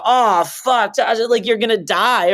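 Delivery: a touch of mock panic. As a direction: play a hammed-up freakout, lightly. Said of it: "I don't sit there and go".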